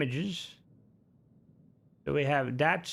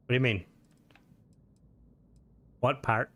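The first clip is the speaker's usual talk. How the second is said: ordinary talk, nothing marked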